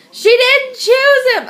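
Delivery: high, loud, drawn-out complaint; hammed-up heartbreak, slowly wailed; mock distraught wail. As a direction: imitate an upset reader wailing. Imitating mom upset over the book.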